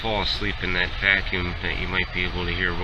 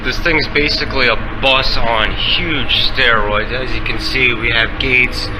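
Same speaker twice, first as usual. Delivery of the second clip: nature documentary; 3 semitones higher, 10 dB louder than usual. Acted. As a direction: narrate like a nature film host. Documentary-style tarmac bus ride.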